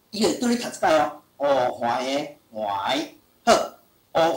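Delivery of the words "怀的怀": 怀的怀 stretched very slowly; slow dictation. Shown as a demonstration. Teacher reading 怀 aloud for a child to write. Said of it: "老师念互你听".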